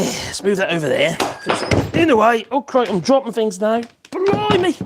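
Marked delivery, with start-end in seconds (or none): 4.1-4.8 surprised blimey. Real, not acted. Dropping things under bright light.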